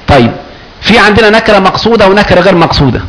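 normal talking voice

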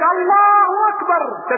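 high, slow, loud cry of God is great; loud takbir. Retold or imitated. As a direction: shout it together, slowly. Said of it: "وكبر الصحابة في البيت".

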